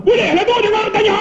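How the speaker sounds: high, cross shout; exasperated. Acted. Fierce principal grants the leave, high exasperated shout. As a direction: snap back at a very high pitch. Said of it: "Dia cakap memang macam tu".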